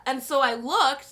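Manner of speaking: usual speaking voice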